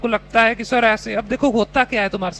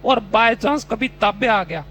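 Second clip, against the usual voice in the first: ordinary talk, nothing marked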